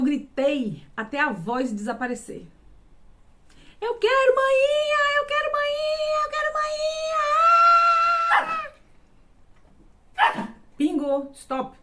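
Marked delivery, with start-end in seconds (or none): 3.8-8.7 very high, loud, slow wail that builds to a shout; crying child's scream. Retold or imitated. Little girl screaming for her mother, crying wail. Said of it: "eu comecei a chorar e gritar".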